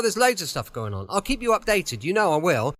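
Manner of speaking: normal talking voice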